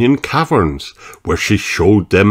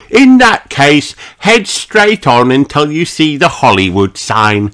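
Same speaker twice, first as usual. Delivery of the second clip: high, emphatic direction-giving; Miss Rabbit; 8 semitones higher, 3 dB louder than usual. Acted. Police Rabbit sends the family toward Hollywood, helpful and emphatic.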